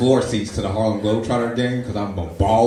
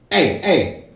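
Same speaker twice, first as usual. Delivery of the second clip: mock scolding; 5 semitones higher, 6 dB louder, much slower than usual. Homeless man scolds him.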